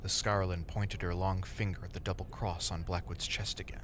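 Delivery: plain reading voice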